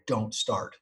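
soft, desperate little plea; begging the bike. Retold or imitated. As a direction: quietly beg the motorcycle not to start. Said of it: "and I'm begging".

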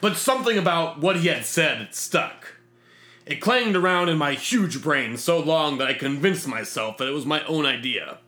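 ordinary delivery

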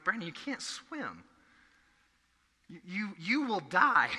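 mock-worried parent telling Brandon no; imitating stern parent. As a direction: spell out the danger to a small child. Parents warning child at pool.

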